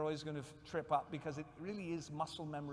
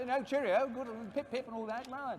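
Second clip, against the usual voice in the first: high-pitched English-gentleman RP chatter; uncle's RP accent; 8 semitones higher, 3 dB louder than usual. Retold or imitated. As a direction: imitate a jolly chin-wagging RP uncle. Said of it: "a proper received pronunciation accent".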